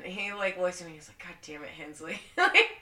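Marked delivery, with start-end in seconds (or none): 1.2-2.2 quietly acted dismay; retold weary cursing. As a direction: imitate a resigned sergeant under his breath. Retold sergeant's resigned reaction.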